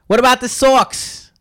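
put-on slow, high Boston accent drawl. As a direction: stretch it out in a thick Boston accent. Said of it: "that accent is horrendous".

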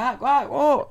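high-pitched mock surprise; feigned surprise. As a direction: act caught unawares, voice jumping high. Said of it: "haciéndome el loco". (sorpresa fingida, en voz aguda)

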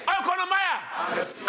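slow, drawn-out high shout. Shown as a demonstration. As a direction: stretch it out and shout. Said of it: "I can't hear you".

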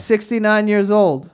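normal talking voice

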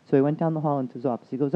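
ordinary delivery

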